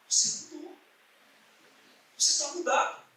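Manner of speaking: espanto contido, agudo e mais baixo; admirado. Retold or imitated. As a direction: comente admirado, com voz aguda e suave. Alguém nota espantado a mudança. (admirado, em voz aguda e suave)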